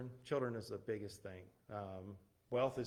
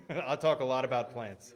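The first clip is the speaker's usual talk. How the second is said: laughing; 3 semitones higher, 9 dB louder than usual. Real, not acted.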